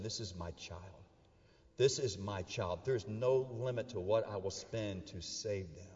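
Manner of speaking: low, soft, weighty delivery; hushed earnest vow. Acted. Parent vowing to save a sick child, hushed earnest resolve.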